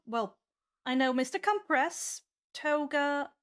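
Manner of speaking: ordinary talk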